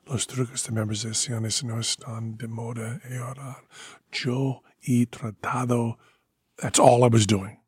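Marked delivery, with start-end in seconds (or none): 0.1-6.0 low murmured rehearsal of Spanish lines; script muttered under breath. Retold or imitated. Rehearsing lines quietly backstage, murmured under breath. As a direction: run the lines half-voiced, barely audible. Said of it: "going over the script at that volume".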